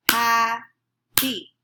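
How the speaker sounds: Ha-py split into two clapped beats; clapped syllables. Shown as a demonstration. Teacher clapping Ha-py syllable by syllable for the class. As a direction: clap out Ha-py beat by beat. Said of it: "clap out how many syllables".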